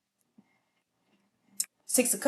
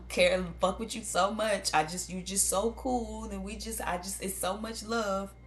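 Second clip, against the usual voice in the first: hammed-up insincere devotion; fake sweet talk; 5 semitones higher than usual. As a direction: lay on phony love thick. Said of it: "come to you and say".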